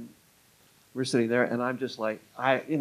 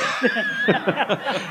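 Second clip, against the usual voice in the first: short laugh; 8 semitones higher than usual. Real, not acted. Laughs at losing the question.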